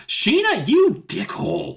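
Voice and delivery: high, drawn-out indignant cry; mock outrage. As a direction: scold her with hammed-up indignation. Mock scolding of a character.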